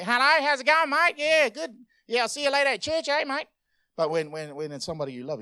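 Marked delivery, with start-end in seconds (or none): 0.0-3.5 loud, high, chummy mate-to-mate chatter; brisk, high-pitched cheery phone voice; normal phone greeting, exaggerated. Shown as a demonstration. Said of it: "when you answer the phone and it's normally".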